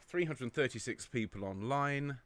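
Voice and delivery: usual speaking voice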